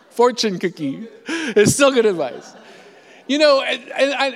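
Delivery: words spilling through real laughter; laughing while talking. Real, not acted. Pastor laughs at his old pastor's advice, talking through laughter. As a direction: keep laughing through the punchline.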